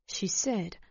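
ordinary delivery

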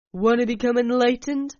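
loud sneering question; mocking taunt. Alec taunts narrator.

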